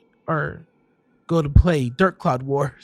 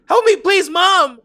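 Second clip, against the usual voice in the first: high, loud screaming for mom; desperate scream; 13 semitones higher, 6 dB louder than usual. Acted. Child grabbed at the door, screaming for mom. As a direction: scream for help at the top of your lungs. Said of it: "I screamed as loud as I could".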